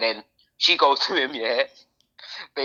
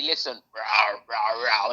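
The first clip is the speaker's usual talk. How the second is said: low, rumbling mock lion talk; lion language; 7 semitones lower, 3 dB quieter, much slower than usual. Man acts out a lion breaking news to the pride, mock lion talk. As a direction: imitate a lion's "rah" in a lowered voice. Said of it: "just talk in lion language".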